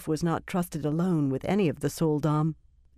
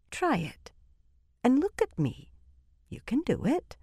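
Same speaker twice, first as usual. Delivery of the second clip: gentle, higher coaxing with slowed key words; encouraging a child; 4 semitones higher than usual. A narrator's reading. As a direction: coax patiently, as if to a small child. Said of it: "as though she were encouraging a child".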